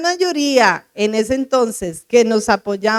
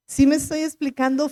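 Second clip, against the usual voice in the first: normal talking voice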